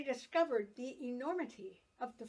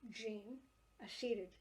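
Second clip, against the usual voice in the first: ordinary delivery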